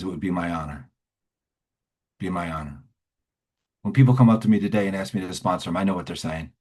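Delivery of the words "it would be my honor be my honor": low, softened, humble reply; sincere acceptance. Retold or imitated. Sponsor answers a dying man's plea, low and sincere. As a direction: let it land quietly and earnestly. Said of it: "And he says".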